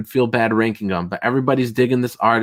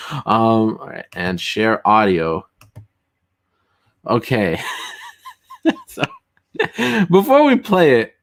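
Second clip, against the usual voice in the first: normal talking voice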